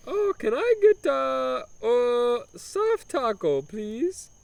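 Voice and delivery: high, slow mock Italian order; Italian accent order. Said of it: "obnoxious broken Italian accent".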